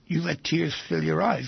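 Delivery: ordinary talk, nothing marked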